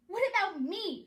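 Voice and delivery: high, loud indignant screech. A narrator's reading. Cinderella demanding the fairy godmother's help, screeching. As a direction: screech in outrage. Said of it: "she screeched".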